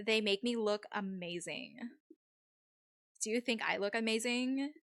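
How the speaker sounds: slightly hammed-up Valley-girl accent. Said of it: "This accent is definitely".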